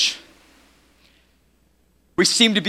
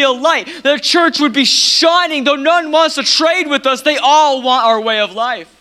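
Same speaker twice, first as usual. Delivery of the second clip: high, loud pulpit declaration; full-force preaching; 10 semitones higher, 5 dB louder than usual. Real, not acted. Preacher urging the church to shine, impassioned preaching.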